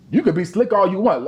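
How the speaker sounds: normal talking voice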